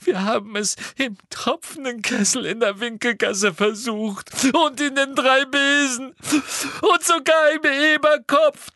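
a distressed schoolgirl; Hermine voice. A narrator's reading. (Hermine mit hoher, verzweifelter Stimme)

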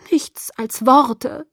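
high, drawn-out indignant dismissal; aggrieved, scornful retort; upset. A narrator's reading. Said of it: "sagte sie aufgebracht".

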